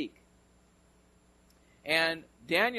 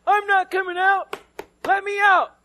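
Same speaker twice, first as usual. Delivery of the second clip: mock trapped yell; 13 dB louder than usual. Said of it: "And he goes".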